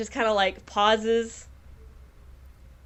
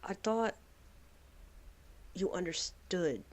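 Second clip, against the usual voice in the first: heartbroken whisper; 2 semitones lower, 8 dB quieter than usual. Acted. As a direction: speak quietly through heartbreak. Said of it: "So she's like".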